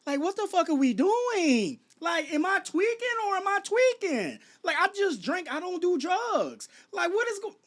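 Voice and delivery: high-pitched exasperated disbelief; real bewildered, high-voiced rant. Real, not acted. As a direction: pitch climbs way up, pleading for sense. Exasperated rant about the situation.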